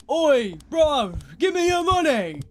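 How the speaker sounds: loud, high put-on London roadman; roadman mugging. Scrawny thirteen-year-old roadman demanding money, loud and high. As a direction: bark it like a cocky teen mugger. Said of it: "coming up to me saying".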